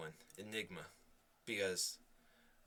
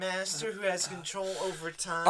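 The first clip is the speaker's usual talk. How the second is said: raised, theatrical interrogation; mock-grand challenge; 4 semitones higher, 4 dB louder than usual. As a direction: declaim it like a mock epic. Mock challenge over time limit.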